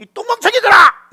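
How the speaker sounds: high, loud mock scolding; hammed-up outburst of frustration; exasperated shout. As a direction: yell at them like a fed-up father. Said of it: "이렇게 얘기하는 거예요".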